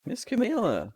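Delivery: high, eager little dog voice calling out; high yappy call to his owner; excited dog call. Acted. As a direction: yelp her name like a puppy. Small dog calls out to Camilla.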